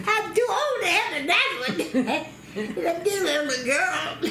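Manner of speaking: high, laughing through the words. Real, not acted. Grandmother laughing while telling about wanting a girl. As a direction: giggle as you explain.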